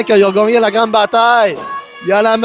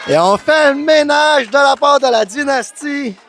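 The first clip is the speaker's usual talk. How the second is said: wrestling commentator; 3 semitones higher than usual. Real, not acted. Live wrestling play-by-play.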